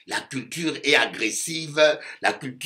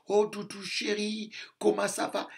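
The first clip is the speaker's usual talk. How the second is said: higher, cooing a hint of a tender wife; mock sweet wife; 8 semitones higher, 9 dB quieter than usual. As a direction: coo it softly like a doting wife. Imitating tender metropolitan wife.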